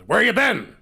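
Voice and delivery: loud, high accusing question; angry demand. Retold or imitated. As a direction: bark it at the woman coming in. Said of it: "He says".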